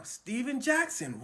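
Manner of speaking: usual speaking voice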